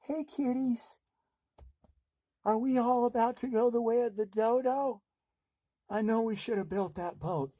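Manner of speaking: high, hammed-up frightened voice; mock scared voice. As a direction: play scared while hiding. Scared hiding under bed.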